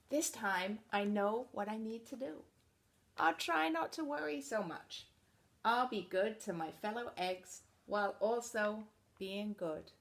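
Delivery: picture book narration; reading to children. Acted. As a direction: read it out to a room of kids.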